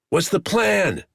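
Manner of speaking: loud, high urgent call; shouting. A narrator's reading. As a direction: yell it over the fight. Quick calls out mid-fight.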